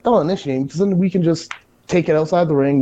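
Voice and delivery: normal talking voice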